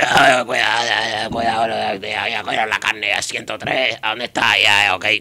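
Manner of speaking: lightly cartoonish Cuban carnicero call; butcher impression. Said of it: "voice is magical".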